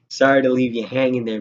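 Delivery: ordinary talk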